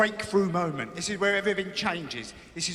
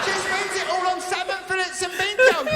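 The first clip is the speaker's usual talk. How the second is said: high pleading voice; mock pleading kid; 12 semitones higher, 5 dB louder than usual. Comic as the kids begging Greg for help. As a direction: beg like a neglected child. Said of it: "There's the kids".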